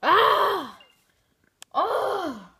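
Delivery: loud high yell of defeat; frustrated yell. Real, not acted. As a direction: cry out at the loss. Losing again.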